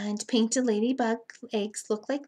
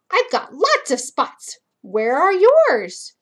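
higher, louder, slow boastful bug; ladybug voice; 5 semitones higher, 7 dB louder, slower than usual. Acted. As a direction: show off like a proud little bug. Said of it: "Down here it says".

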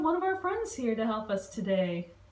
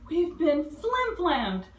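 higher, louder alarmed cry; yelled; 7 semitones higher, 4 dB louder than usual. Acted. Dilly warning the bugs about the judges, yelling. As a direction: sound the alarm to the other bugs. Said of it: "She yelled".

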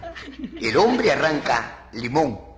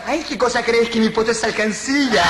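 high, camp outrage; hammed-up shrieked retort; 7 semitones higher than usual. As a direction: protest in mock offence, pitched high.

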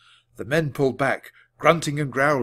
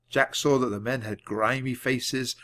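ordinary delivery

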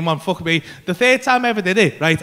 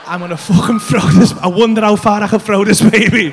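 lowered pitch, much firmer volume; escalating intrusive thought; 4 semitones lower, 4 dB louder than usual. Retold or imitated. New dad's thought taking over, low forceful voice.

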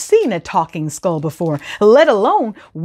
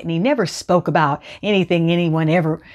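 plain reading voice

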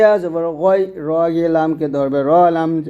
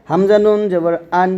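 usual speaking voice

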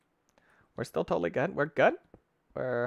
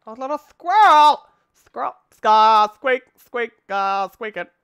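loud, high playful shrieks; excited squealing; 9 semitones higher, 15 dB louder, slower than usual. Pretend. Gamer squealing at a squirrel in game, playfully excited. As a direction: squawk it out like an excited animal call.

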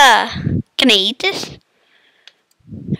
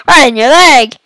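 loud taunt; 6 dB louder than usual. Acted. Taunt repeated loudly.

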